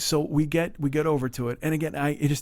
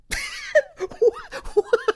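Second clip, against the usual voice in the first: burst of laughter. Real, not acted. Storyteller breaks up over the mustard question, laughing. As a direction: crack up helplessly.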